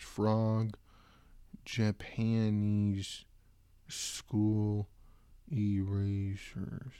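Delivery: drawn out word by word; slow typing dictation. Real, not acted. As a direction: spell it out as you type.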